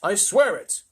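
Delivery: poor astronaut crying out; protesting cry. A narrator's reading. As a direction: plead to be believed. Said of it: "cried poor Shuckworth".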